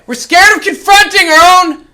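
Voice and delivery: loud, high, preaching shout; yelled sermon. Acted. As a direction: yell it out with conviction. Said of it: "I'm gonna yell it again".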